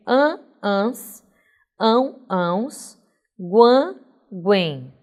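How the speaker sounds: "An, ans, ão, ãos, guan, guem" as separate nasal endings; nasal sounds. Shown as a demonstration. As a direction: sound out each nasal ending in contrasting pairs. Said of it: "você tem os sons nasais". (terminações nasais pronunciadas uma a uma, aos pares)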